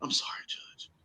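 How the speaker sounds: fading into a whisper; mock apology to the judge, whispered. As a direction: hush it out, sorry and pleading. Pretend apology fades to whisper.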